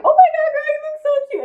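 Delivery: high, bubbly gushing compliment; over-the-top high fangirl gush; gushing friend. Retold or imitated. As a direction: squeal it like an excited girlfriend.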